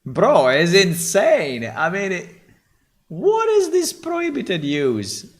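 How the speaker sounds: high, loud disbelief; incredulous outburst. Real, not acted. The tool blocks his prompt, he exclaims in disbelief. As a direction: throw up your hands in disbelief.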